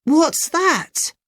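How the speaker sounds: put-on high, surprised boy's voice; curious boy. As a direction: ask sharply like a kid who spotted something. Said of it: "Ben stood up".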